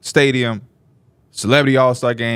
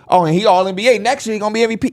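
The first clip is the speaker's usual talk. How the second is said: mock hype fan; 8 semitones higher, much faster than usual. To co-hosts, mocking overhyped fan logic, hammed-up. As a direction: sneer at the overhyped fan take.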